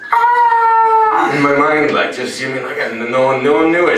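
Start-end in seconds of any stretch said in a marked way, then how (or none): 0.1-1.3 high excited shriek; joyful scream. Retold or imitated. Winning at the shop, screaming inside. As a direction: let out a shriek of joy. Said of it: "you're just like".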